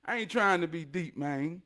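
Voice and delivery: ordinary talk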